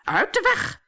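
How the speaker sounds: high-pitched impatient command; bossy order. A narrator's reading. Mother pushing her daughters aside, bossy.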